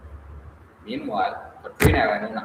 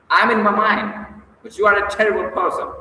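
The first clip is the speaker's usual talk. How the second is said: higher, louder, fast furious retort; angry retort; 9 semitones higher, 6 dB louder than usual. Acted. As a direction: fire it back at him, fast and furious. Furious accusation, much higher louder.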